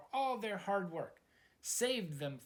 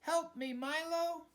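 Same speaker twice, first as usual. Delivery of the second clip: shouted from afar; 12 semitones higher than usual. Acted. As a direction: cry for help across the distance. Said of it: "a voice shouted".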